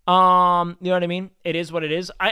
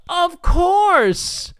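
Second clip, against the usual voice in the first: high, loud, slow obvious tone; mock matter-of-fact; 9 dB louder, much slower than usual. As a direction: say it like it's totally obvious. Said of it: "she said it like".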